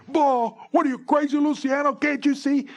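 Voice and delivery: high, loud outraged pitcher; furious mock screech at a bad call; yelling and screaming. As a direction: scream at the umpire in protest. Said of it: "he's yelling and screaming".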